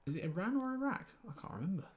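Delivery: a high comic squeak of bafflement; squeaky high mock confusion. Confused about Iran and Iraq.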